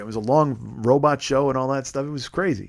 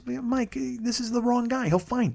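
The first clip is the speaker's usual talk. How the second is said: soft, high, slow calming voice; imitated soothing friend, hushed and high-pitched; voice of reason; 10 semitones higher, 6 dB quieter, slower than usual. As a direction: gently talk a worked-up friend down. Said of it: "is the fucking voice of reason".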